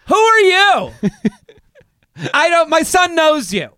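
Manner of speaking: high, loud mom impression; march up and demand it as a pushy mom; high-pitched mock confrontation; brash mom. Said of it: "walk to him and go".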